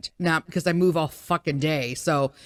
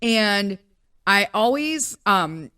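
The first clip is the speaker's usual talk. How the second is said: ordinary delivery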